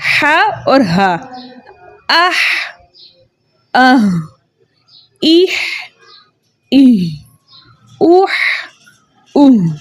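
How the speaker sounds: ह and ह drilled in pairs, breathy then throaty; letter sounds demo. Shown as a demonstration. Quran teacher drilling the letters for students. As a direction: sound out each letter pair slowly. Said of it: "ये हा और हा को पढ़ते वक्त".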